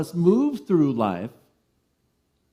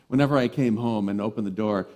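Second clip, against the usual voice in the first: normal talking voice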